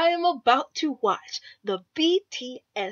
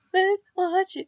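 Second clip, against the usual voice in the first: excited call; 7 semitones higher, slower than usual. Real, not acted. Repeats it, loud then hushed.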